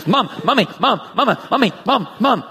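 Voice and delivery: lightly voiced clingy puppy nagging mom; coned puppy crying for mom; puppy calling mom. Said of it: "pile drives into her with his cone head".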